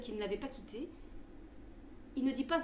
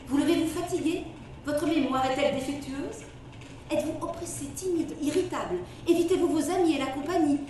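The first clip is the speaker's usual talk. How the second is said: ad read; 2 semitones higher, 5 dB louder than usual. Acted. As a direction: sell it like an old radio ad. Reads a quack remedy advertisement.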